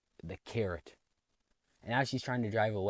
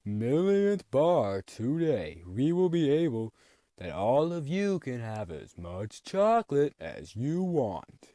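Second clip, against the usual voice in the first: slow, loud, grand cartoon boss voice; boss announcement; 4 semitones higher, 6 dB louder, much slower than usual. Factory owner announcing free chocolate, slow and loud. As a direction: boom it out like a pompous factory owner. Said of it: "Then Mister Nezer's like".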